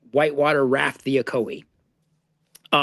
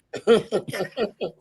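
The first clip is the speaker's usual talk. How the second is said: laughing; 7 semitones higher than usual. Real, not acted. Laughs at seventy pages.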